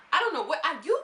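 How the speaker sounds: loud, high Jocelyn voice; loud, high Jocelyn accent impression. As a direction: blast it out as Jocelyn. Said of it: "my Jocelyn accent is getting better".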